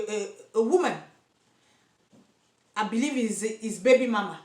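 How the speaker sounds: ordinary delivery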